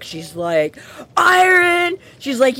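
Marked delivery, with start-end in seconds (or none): 1.2-2.0 an outraged faerie spitting one word; angry shout. Retold or imitated. Furious faerie yelling about the iron. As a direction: bark the word high and loud. Leah gets pissed off, shouts.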